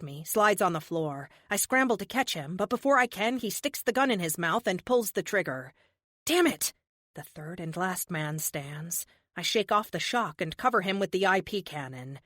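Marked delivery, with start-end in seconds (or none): 6.3-6.7 sharp, high burst of frustration; shocked curse. Acted. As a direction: spit it out in shock. Shock at the suicide.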